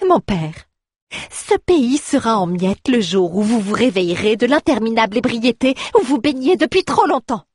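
rising into a high accusation; daughter's cold scorn building high; indignant reproach. Acted. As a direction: cut him down. Daughter attacks father's drunkenness.

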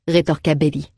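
ordinary delivery

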